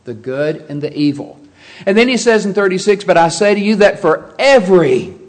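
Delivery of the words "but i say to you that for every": every leaned on loud at the end of the quote; preacher to congregation, hitting every in the verse; stress on every. Shown as a demonstration. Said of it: "that's an emphatic word".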